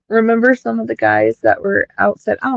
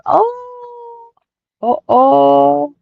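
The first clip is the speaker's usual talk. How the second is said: surprised; 6 semitones higher, 5 dB louder, much slower than usual. Real, not acted. Sudden reaction on stream.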